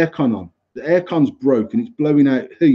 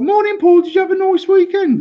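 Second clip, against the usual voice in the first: very high, louder sing-song staff voice; mocking chirpy greeting; 3 dB louder than usual. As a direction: mimic an over-cheery receptionist in falsetto. Staff's cheery greeting retold mockingly.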